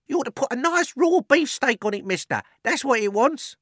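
high, loud teasing call; butcher's boy teasing the fat man; cheeky butcher's boy. A narrator's reading. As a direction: shout advice across the street.

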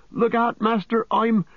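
very high-pitched yelled warning, cut short; Sam, desperate yell. A narrator's reading. Sam warning his master before being stifled, desperate yell. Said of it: "he yelled".

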